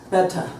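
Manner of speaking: "better" with a crisp T and no final R; British T. Shown as a demonstration. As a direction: sound the T in "better", leave off the R. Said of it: "the British would say".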